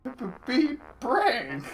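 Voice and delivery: high, loud droid beeps; robot voice. Pretend. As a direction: blare it like an angry robot. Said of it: "voicing the droid".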